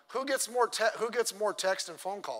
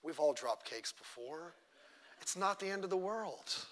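tender comfort; 10 dB quieter than usual. Retold or imitated. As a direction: hush a friend who dropped the cake. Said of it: "hugging her and saying".

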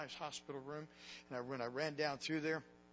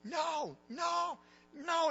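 high, loud anguished cry of no; screaming no; 13 semitones higher, 7 dB louder, much slower than usual. Retold or imitated. Husband screams no at his dying wife's bedside. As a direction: wail the word no in despair. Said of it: "I started screaming".

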